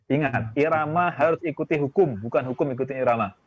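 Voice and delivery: normal talking voice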